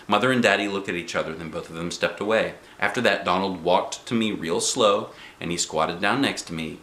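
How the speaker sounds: ordinary delivery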